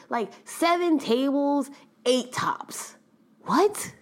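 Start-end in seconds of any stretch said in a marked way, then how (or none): 3.4-3.9 incredulous. Acted. Mock disbelief at big tables.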